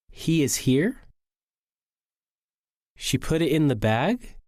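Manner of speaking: statement turned question, rising on "here" and "bag"; doubtful rising intonation. Shown as a demonstration. Speaker doubting a statement, rising intonation. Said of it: "statements also end with rising intonation when the speaker has doubt".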